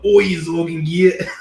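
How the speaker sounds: a mock orc shouting at his underling. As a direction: bellow it like a Warhammer orc boss. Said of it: "forty K orc voice".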